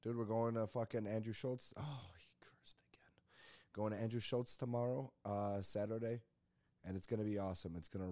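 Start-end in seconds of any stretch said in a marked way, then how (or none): 1.8-3.1 hushed whispered aside; mock whisper. Podcaster tutting at his own cursing, mock whisper. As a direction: whisper it like a scandalized onlooker.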